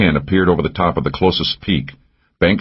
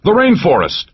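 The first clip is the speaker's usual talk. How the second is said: high yelled direction; shout; 13 semitones higher, 3 dB louder than usual. A narrator's reading. Mac yelling to the group under fire, loud shout. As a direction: call out where to run.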